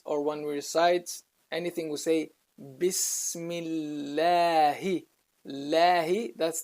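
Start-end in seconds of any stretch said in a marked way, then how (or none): 2.8-6.3 slow recited "Bismillahi" with thin, long "læ"; light lam. Shown as a demonstration. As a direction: draw out "Bismillahi", keeping the L light. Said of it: "the name of Allah becomes Allah".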